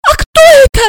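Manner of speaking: tiny, very high scared voice; loud, terrified high squeal; frightened squeak. A narrator's reading. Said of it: "испуганный писк Ксении".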